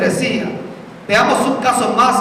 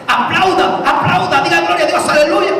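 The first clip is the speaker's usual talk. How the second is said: mock pastor yelling; 5 semitones higher than usual. Preacher mimics a visiting pastor shouting at the church, mock yell. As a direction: ham up a pastor barking at the flock to clap. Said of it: "Que le gritaba cada rato".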